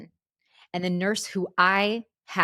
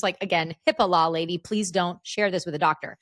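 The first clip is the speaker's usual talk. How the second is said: usual speaking voice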